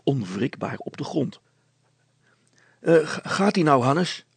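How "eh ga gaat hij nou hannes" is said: louder, raised, puffing question; panting. A narrator's reading. Brilstra asks his son mid-pedalling, panting. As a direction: call out short of breath from pedalling. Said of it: "hijgde Brilstra".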